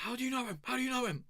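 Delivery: high squeaky interrogation; mock guard. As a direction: squeak the question at him. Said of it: "They were like".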